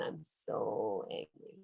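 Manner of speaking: a hint of a grumpy kid, low and slow; mock sulky anger. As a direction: sulk the words like a cross child. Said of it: "Oh, when my toy breaks down".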